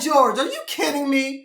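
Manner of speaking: loud, high disbelief at the coach; incredulous outburst. Retold or imitated. To coach George Karl, loud incredulous retort. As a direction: throw it back at him, can't believe it. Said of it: "I'm like".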